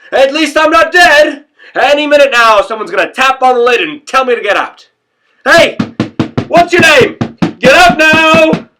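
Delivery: high, full-voiced frantic shout. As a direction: yell it as if to rouse someone inside a box. Rant peaks in imagined shouted summons.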